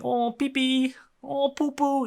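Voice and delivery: high-pitched, silly voice in the head; mock-annoying high voice; annoying little voice. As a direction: pipe it high, like a pestering little noise. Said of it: "I guess the most annoying voice".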